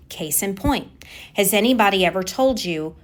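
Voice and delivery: ordinary talk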